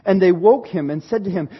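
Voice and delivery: ordinary talk, nothing marked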